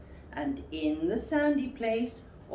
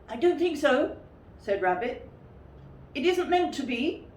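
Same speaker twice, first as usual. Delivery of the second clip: higher, louder put-on Rabbit voice; disguised voice; 8 semitones higher, 4 dB louder than usual. Rabbit disguising his voice for Pooh, higher and louder. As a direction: pretend to be someone else. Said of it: "in a different sort of voice".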